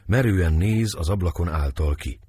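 plain reading voice

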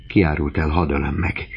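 plain reading voice

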